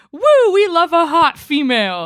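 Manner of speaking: put-on drunk heckler. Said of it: "this drunk lady in the front row just goes".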